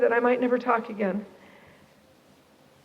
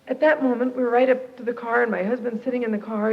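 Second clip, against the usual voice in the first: ordinary talk, nothing marked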